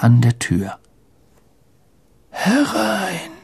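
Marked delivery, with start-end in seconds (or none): 2.3-3.3 put-on high, soft, feeble old wizard; weak old voice. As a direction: call out faintly as a frail old man. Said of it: "mit schwacher Stimme". (mit schwacher Greisenstimme)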